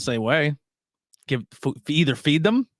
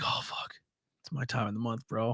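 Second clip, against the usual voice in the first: breathy whispered aside; mock guy whisper; 3 semitones lower, 11 dB quieter than usual. A guy confessing to his friend, whispered. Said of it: "when the guy's like".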